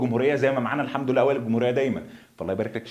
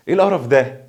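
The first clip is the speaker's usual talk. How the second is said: louder, irritated outburst; exasperated at a disturbance, louder; real annoyance; 3 semitones higher, 6 dB louder than usual. Real, not acted. Teacher fed up with noisy birds, annoyed outburst.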